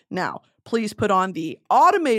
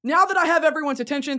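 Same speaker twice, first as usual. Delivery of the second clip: mock announcer; 7 semitones higher, faster than usual.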